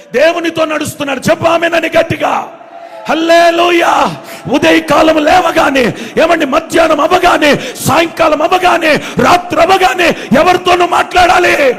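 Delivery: high, shouted, rhythmic exhortation; full-force preaching. Real, not acted. Preacher rousing the congregation to answer, shouted preaching. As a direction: drive each phrase home at a shout.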